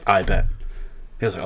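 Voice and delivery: ordinary delivery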